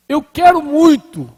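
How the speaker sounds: high, loud, drawn-out plea; acted earnest yearning. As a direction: play someone insisting on their strong desire. Acts out a struggling believer's plea.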